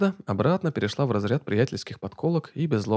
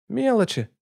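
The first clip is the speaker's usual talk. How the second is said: high scoffing word; snorting dismissively; 11 semitones higher, 3 dB louder, slower than usual. A narrator's reading. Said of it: "фыркнула Лана".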